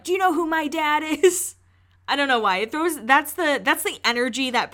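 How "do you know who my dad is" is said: higher, whiny spoiled-brat voice; a touch of the spoiled kid bragging about his father; entitled rich kid. Retold or imitated. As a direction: lightly mimic a rich kid throwing his weight around. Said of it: "the kids who are like".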